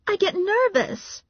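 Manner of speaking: very high, slow protest; drawn-out, high-pitched defensiveness; defensive Valerie. A narrator's reading. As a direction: make excuses in a high voice. Narrator voices defensive Valerie.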